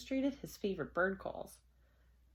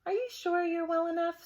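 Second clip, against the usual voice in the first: fussy principal; 9 semitones higher, 4 dB louder than usual. Acted. Principal concerned.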